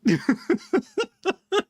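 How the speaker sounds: long high squeaky laugh; real high giggle. Real, not acted. Laughing at minnow mixup.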